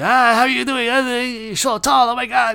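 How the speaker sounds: mock a tipsy uncle fussing over you at a cookout; put-on high-pitched greeting, babbling and over the top; gushing drunk uncle. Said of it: "your uncle hugs you".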